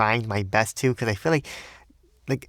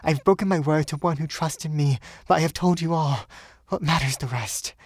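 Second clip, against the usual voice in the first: put-on raised voice of a devoted young woman; young woman pleading; 5 semitones higher than usual. Woman confessing to her lover, in a higher pleading voice.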